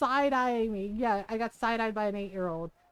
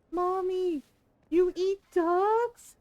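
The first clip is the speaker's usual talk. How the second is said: put-on little girl voice; 6 semitones higher, much slower than usual. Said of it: "she was just like".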